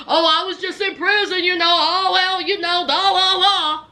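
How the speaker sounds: breezy, quick, shrugging it all off; dismissive brush-off. Retold or imitated. Imitating Arthur brushing off questions about prison, breezy and dismissive. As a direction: wave the subject away like nothing happened.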